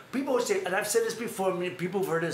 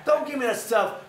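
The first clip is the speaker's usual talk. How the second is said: mock tough rebuke; 6 semitones higher, 6 dB louder than usual. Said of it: "He says".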